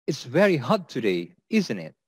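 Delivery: "isn't it" slightly falling at the end; falling tag intonation. Shown as a demonstration. Teacher demonstrates small talk about weather, falling tag. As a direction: let "isn't it" drop, not asking. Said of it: "intonation is slightly going down at the end".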